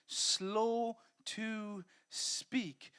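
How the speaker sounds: very slow, each word stretched; slow, drawn-out preaching stress; drawn-out emphasis. Shown as a demonstration. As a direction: let the words hang slowly. Preacher drawing out verse phrase.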